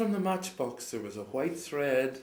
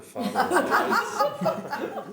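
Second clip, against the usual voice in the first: high, slow line broken by laughter; can barely get it out for laughing; laughing through the line; 8 dB louder, much slower than usual. Real, not acted. Storyteller to listeners, cracking up as she reads the line.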